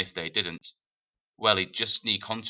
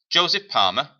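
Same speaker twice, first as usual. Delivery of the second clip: raised, questioning call of a name; a sharp, raised call of the boy's name; 6 semitones higher, 8 dB louder than usual. Acted. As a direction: call him out loud from behind. Stranger calls the boy's name.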